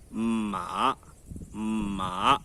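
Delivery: "ma" very low in pitch, said twice with a gap; deep, long Mandarin third tone on each "ma". Shown as a demonstration. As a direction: drop "ma" to the bottom of your voice and draw it out. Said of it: "ter esse som grave".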